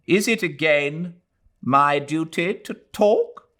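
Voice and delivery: a hint of an irritable old mother; mother weary of her son; old woman, weary. A narrator's reading. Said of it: "asked Missus Moore".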